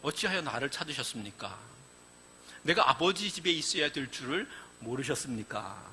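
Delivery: put-on young Jesus coolly answering his worried parents; nonchalant. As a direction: answer as if nothing is wrong. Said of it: "천연덕스럽게 이런 이야기를 합니다".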